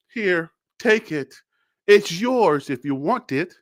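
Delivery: lazy handoff. Retold or imitated. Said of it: "Not our Shedeur".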